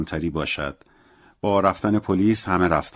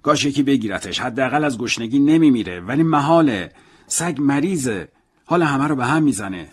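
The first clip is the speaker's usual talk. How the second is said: put-on higher, distressed old man's voice; a fretting old man, voice pitched up; upset old man; 6 semitones higher than usual. A narrator's reading. Old Salamano frets to Raymond about his sick dog, distressed.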